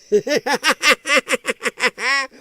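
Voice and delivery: high burst of real laughter; cracking up with repeated oh's; loud laughter. Real, not acted. Reacting to a brutal joke, laughing hard out loud.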